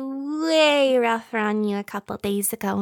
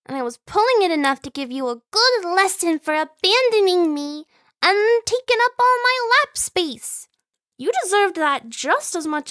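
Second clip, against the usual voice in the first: high, loud, exaggerated complaint; mock scolding; 7 semitones higher, 4 dB louder than usual. Sibling complains about lost space, mock scolding. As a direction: scold with punched, rising emphasis.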